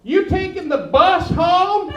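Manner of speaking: slow, loud, exaggerated words; slow overarticulated. Mocking sign-language helpers, slow and loud. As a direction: spell it out slowly like signing.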